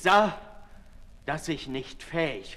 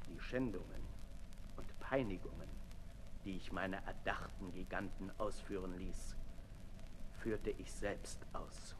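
sinking to a low, much softer whisper; hushed confession; 9 semitones lower, 15 dB quieter than usual. Acted. Sade confesses his torments to Marat, low hushed whisper.

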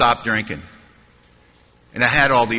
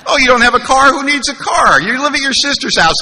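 much higher and louder, beaming with joy; delighted sponsor; 11 semitones higher, 4 dB louder than usual. Retold or imitated. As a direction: cheer him up about his wreckage, grinning. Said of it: "big smile on his face".

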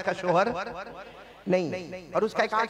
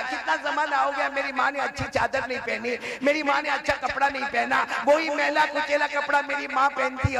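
put-on pleading child; 7 semitones higher than usual. Orphan boy pleads with uncle.

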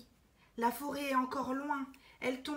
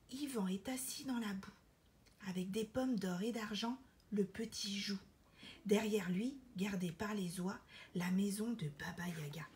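softer, whispered narration; hushed storytelling; 4 semitones lower, 5 dB quieter than usual. A narrator's reading. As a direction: draw listeners in with a hush. Hushed narration near witch house.

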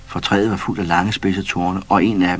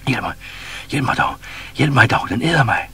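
pleading, higher, panicky help; frightened cry; 6 semitones higher than usual. A narrator's reading. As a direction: beg for your life. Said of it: "skreg gazellen".

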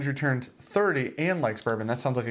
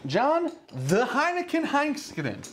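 put-on slow, hammy German accent; 12 semitones higher, slower than usual. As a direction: stretch the name out in a mock German accent. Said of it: "are you German?".

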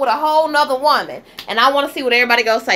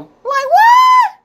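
much higher, much louder shocked "what?"; shocked shout; 8 dB louder than usual. Real, not acted. Reacting to a man insulting his mother, shocked shout. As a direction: yelp WHAT at the screen in disbelief.

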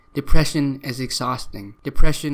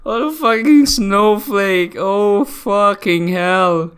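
exasperated disbelief; 6 semitones higher, 4 dB louder, slower than usual. Real, not acted. Reacting, laughing in disbelief.

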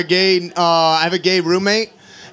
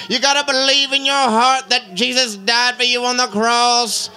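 slow, drawn-out preacher cadence; mock street preaching; 3 semitones higher, slower than usual. Dad preaching on the street, slow mock sermon.

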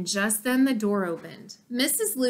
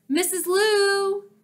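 high, loud, slow call; yell; 9 semitones higher, 5 dB louder, slower than usual. A narrator's reading. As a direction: holler the name toward the house. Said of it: "she yelled".

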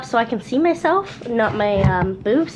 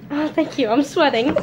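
usual speaking voice

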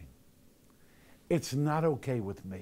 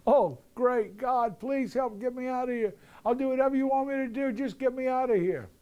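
high, frantic begging, much higher pitched; desperate pleading; 12 semitones higher than usual. Retold or imitated. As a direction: promise anything in a high panicked plea. Man on cliff pleading with God.

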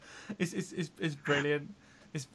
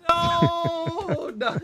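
a sudden high, loud, laughing no; high protesting no; 12 semitones higher, 5 dB louder than usual. Real, not acted. Host protesting his station ident was botched, high loud no. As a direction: cry out no in mock protest.

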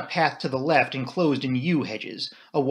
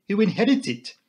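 put-on higher-pitched French-accented question; Poirot; 7 semitones higher than usual. A narrator's reading. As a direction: ask it as a Belgian detective. Narrator voices Poirot.

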